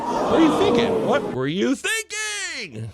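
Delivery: high, hammed-up wail of blame; comic shriek, drawing out the last question; mock outraged fan. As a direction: act out an over-the-top fan tantrum. Mimics fans blaming coach.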